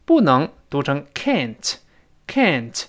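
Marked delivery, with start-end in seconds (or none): 1.1-2.8 American "can't" with the open vowel of "cat"; American pronunciation. Shown as a demonstration. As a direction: say "can't" slowly the American way, like "kant". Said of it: "美音重把啊变成哎". (美音，can't读成“哎”音)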